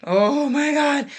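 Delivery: ordinary delivery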